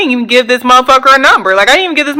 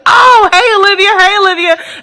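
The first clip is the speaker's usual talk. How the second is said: excited greeting; 10 semitones higher than usual. Retold or imitated. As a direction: call out her name like a happy surprise. Ex-boyfriend greets her at Starbucks.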